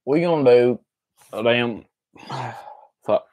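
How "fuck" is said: ordinary delivery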